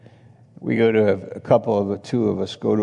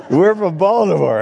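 higher, louder retold reply; amused mock exclamation; 10 semitones higher, 7 dB louder than usual. Preacher replaying his reply for a laughing congregation, amused and loud.

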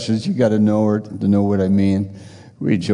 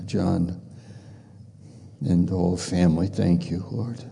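quiet prayer; 3 semitones lower, 5 dB quieter than usual. Acted. Preacher thanking God for family, in soft prayer. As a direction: pray it softly to the Lord.

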